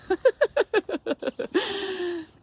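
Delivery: high, loud burst of laughter; real high-pitched laughing fit. Real, not acted. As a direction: crack up at your own act. Laughs at own acted plea.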